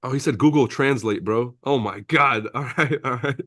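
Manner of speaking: usual speaking voice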